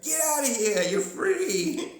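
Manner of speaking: very high, loud shouts like driving off a dog; shooing shout. Pretend. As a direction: yell "Get!" to chase them away. Chasing aliens off again.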